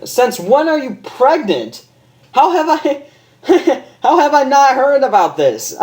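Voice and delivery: high, laughing disbelief; cracking up in shock; stunned doctor. Acted. Doctor reacting to the pregnancy, high laughing disbelief.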